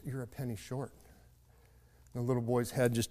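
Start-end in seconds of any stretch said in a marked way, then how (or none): 0.0-0.9 gently breaking bad news. Storekeeper tells the boy he is short, softly. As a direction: say it softly, reluctant to disappoint.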